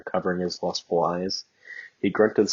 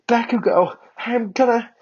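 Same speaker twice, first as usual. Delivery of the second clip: breathless cry; 6 dB louder than usual. Acted. She cries out mid-act.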